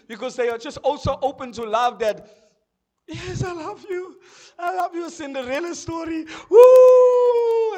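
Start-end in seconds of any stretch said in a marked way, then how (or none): 3.1-7.7 high, loud, squealing mock delight; mock swooning squeal; swooning woman. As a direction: ham up a lovestruck girl shrieking. Mocking swooning women.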